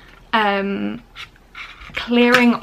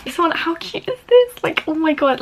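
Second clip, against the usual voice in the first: excited squeal; 7 semitones higher than usual. Real, not acted. Gushing over cute packaging.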